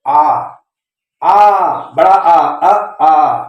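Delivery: "आ" drawn out long and open, set against short "अ"; long aa sound. Shown as a demonstration. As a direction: stretch "आ", then clip "अ". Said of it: "यू का pronunciation कैसे पढ़ेंगे".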